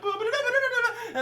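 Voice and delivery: high, loud vocal trumpet fanfare; herald's fanfare. Acted. Heralds announcing the edict, mock fanfare. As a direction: imitate a trumpet flourish with the mouth. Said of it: "so the heralds are like".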